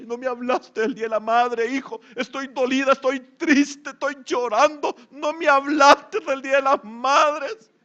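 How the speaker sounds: hammed-up wounded mother, rising toward a fake cry; mock hurt mother. Preacher mocking a mother hurt her child forgot Mother's Day, ending in a mock cry. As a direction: complain like a guilt-tripping mom, ending in a mock sob. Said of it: "las mamás están esperando que el niño le lleve un regalito".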